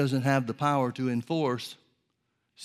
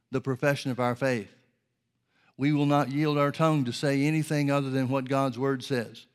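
roaring rallying speech. Acted. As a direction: proclaim it like a battle cry. Declares against Satan in prayer.

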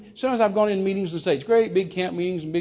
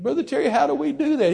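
high, pleading question; mock tearful plea; 9 semitones higher than usual. Tearful preacher begging the guest for help, lightly acted plea. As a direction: plead as if near tears. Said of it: "He's just in tears by now".

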